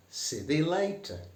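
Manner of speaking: high Northern "See thee later"; broad Yorkshire farewell. Shown as a demonstration. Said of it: "you can say".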